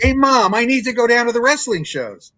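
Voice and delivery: put-on high, loud pleading little kid; little boy begging his mom to take him. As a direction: whine it up like a kid asking mom.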